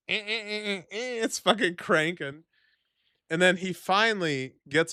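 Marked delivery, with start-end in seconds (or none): 0.1-2.4 high stuttering engine noise; repeated strained cranking sound; imitated car cranking. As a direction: mimic a starter that won't catch. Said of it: "I go to start it, and it's just".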